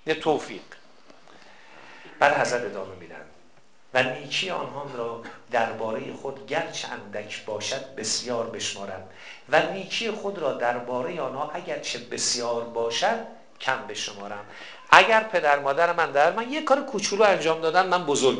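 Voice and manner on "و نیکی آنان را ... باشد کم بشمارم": quiet prayer recitation. Acted. Preacher reciting the imam's prayer to the congregation, quietly. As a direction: softly read the prayer, a touch hushed.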